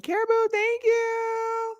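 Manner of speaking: very high, stretched-out thank-you; singsong thanks. Real, not acted. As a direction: sing out the thanks in a high squeal, slowly.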